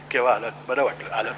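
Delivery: low, hushed small talk; very soft speaking. Acted. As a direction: imitate someone speaking very softly. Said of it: "फार हळू बोलायला लागलं".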